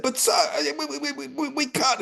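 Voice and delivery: high, stuttering mock fear; stammering guards. Guards stammering to the king, high and frightened. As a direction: stammer out the refusal.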